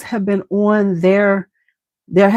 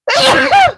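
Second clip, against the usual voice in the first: high laugh; 5 dB louder than usual. Real, not acted. Laughing after sneeze.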